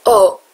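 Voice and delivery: "او" said as a clear O, no h; bare O sound demo. Shown as a demonstration.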